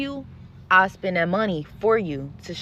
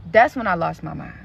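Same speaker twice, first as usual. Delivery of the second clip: ordinary talk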